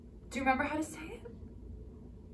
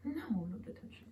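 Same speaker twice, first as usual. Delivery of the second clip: whispered reassurance to the cat; soft whisper; 3 semitones higher, 13 dB quieter than usual. Real, not acted. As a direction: breathe it out, no voice. Soothing the cat.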